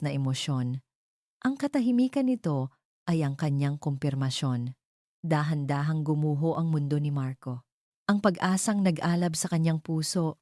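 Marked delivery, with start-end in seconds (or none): none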